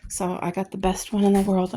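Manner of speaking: usual speaking voice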